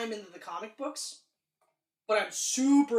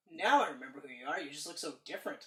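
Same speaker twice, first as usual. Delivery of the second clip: mock Wolverine; 6 semitones lower, 4 dB quieter, faster than usual. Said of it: "have Wolverine go".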